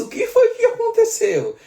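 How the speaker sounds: high, startled asking; imitating his own surprise at the news; surprised question. Pastor retelling his surprised reply to a friend, high startled question.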